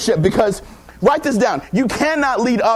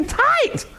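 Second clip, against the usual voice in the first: very high-pitched squealed cue; high hype call. Real, not acted. Speaker asking the audience to repeat, very high hype call. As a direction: pitch it way up for the crowd to echo. Calls for crowd to echo.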